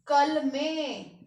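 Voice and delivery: कलमें very slow, nasal ending ें held; slow nasal plural. Shown as a demonstration. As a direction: stretch कलमें to show the bindi. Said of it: "यहां पर भी बिंदी लगेगी". (कलमें धीरे, बिंदी सुनाते हुए)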